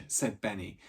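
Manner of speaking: ordinary delivery, nothing marked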